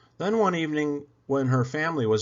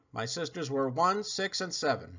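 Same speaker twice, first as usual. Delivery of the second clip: ordinary delivery, nothing marked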